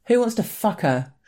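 high, carrying yell from the back. A narrator's reading. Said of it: "yells a guy from the back".

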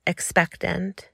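usual speaking voice

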